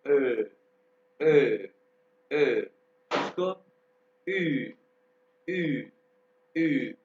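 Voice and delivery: ए and ई repeated clearly; umlaut sounds. Shown as a demonstration. Teacher demonstrates umlaut vowels for the class. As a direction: drill ए then ई. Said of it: "इसको कैसे करेंगे".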